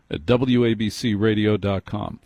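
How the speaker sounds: ordinary talk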